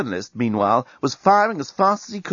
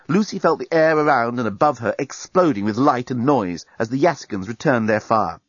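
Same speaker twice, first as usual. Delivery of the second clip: plain reading voice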